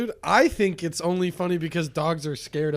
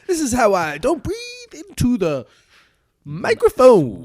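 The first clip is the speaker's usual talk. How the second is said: radio DJ voice; 9 semitones higher, 6 dB louder than usual. Pretend. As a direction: croon it like a radio host. Mocking radio DJs.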